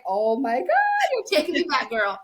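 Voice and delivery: retold thrilled squeal; delighted amazement. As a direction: swoon at the nostalgia. Retold delighted reaction to accent.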